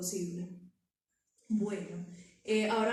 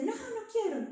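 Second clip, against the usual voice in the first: put-on much higher child's refusal; child yelling no; 12 semitones higher than usual. Child shouting at parent mid-tantrum, high refusal. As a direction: yelp it like a child who won't be hugged. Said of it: "Puede que el niño te grite".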